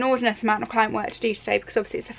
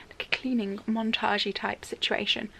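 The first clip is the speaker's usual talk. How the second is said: whisper; 9 dB quieter than usual. Real, not acted. Whispering aside to camera.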